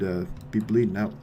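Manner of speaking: usual speaking voice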